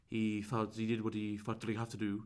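mock the odd posh LA accent, understated; odd posh LA-style accent. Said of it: "and he said like that".

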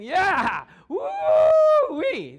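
whooping "Yeah! Woo-wee!" high and loud; an all-out excited yell with pitch leaping. Shown as a demonstration. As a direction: cheer like you're jumping with your arms waving. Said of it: "When I think of excited".

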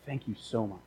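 very soft, low, choked-up thanks; acting a crying boss, barely audible; hushed, overcome gratitude, lightly played; mock tearful. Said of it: "And he's, like, crying".

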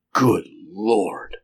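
hushed gasp of shock; breath-caught exclamation. A narrator's reading. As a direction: gasp it out, stunned. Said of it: "gasped Carnes".

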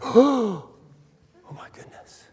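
mock gasp, high "oh," then a whisper; hammed-up hushed shock; shocked congregation. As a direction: imitate a scandalised crowd gasping.

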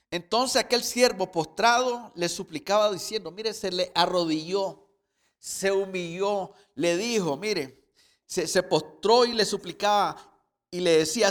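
loud, emphatic sermon reading; fervent preacher voice; forceful preaching. Acted. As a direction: preach the parable out to the congregation. Preacher narrating parable loudly.

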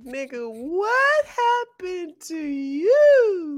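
high, loud, slow mock shock; drawn-out loud high astonished question; shocked friends. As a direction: act out friends gasping at the weight gain. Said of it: "They gonna be like".

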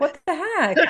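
high, puzzled "what the heck"; mock disbelief. Mom reacting to her friends' texts, in mock disbelief. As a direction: feign amazement.

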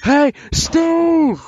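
loud, high call; to someone hard of hearing. Pretend. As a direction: shout so he can hear. Calling out to hard-of-hearing Steve.

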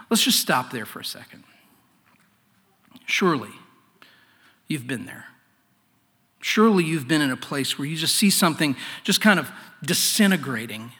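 normal talking voice

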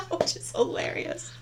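high breathy laugh through words. Real, not acted. Laughing at kids wanting likes.

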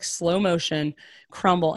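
ordinary talk

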